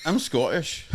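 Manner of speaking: badly faked Scottish accent; dodgy put-on Scottish voice. Shown as a demonstration. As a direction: try a short line in Scottish. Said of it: "I can fucking do Scottish".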